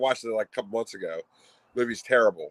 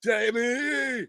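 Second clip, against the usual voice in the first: high-pitched drawn-out impression call; hammy high holler; Arnie; 13 semitones higher than usual. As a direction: impersonate an action hero calling out, last vowel stretched. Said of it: "That Arnie impression is great".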